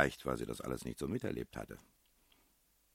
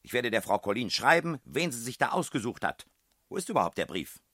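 high and loud indignation; landlady demanding the letter; angry ranting; 9 semitones higher, 8 dB louder than usual. A narrator's reading. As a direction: keep threatening, still boiling. Landlady still raging.

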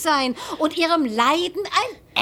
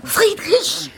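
calling out; 7 semitones higher than usual. Acted. Wife wakes dozing husband.

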